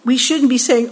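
normal talking voice

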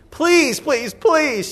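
put-on high, pleading little girl; begging child. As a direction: imitate a child wheedling a parent. His youngest child begging for shoes.

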